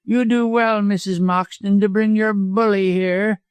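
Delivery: high-pitched, spiteful villain; venomous cowardly lawyer. A narrator's reading.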